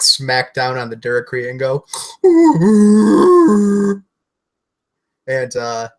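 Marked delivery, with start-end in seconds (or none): 1.9-4.0 loud, high growling Wookiee roar. Acted. Wookiee player roars as the vibroax smacks down. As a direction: let out a big Wookiee bellow.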